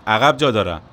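loud call over the engine; shouting from window. Acted. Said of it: "از پنجره داد زد".

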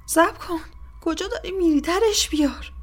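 high, frantic captive plea; desperate crying out. A narrator's reading. Captive cries after the departing torturer, frantic plea. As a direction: call out high, begging them not to go.

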